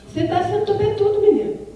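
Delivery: high, loud parental telling-off; scolding parent. Retold or imitated. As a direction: scold the cheeky child sharply. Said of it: "Fala assim com a gente". (bronca de mãe, alta e aguda)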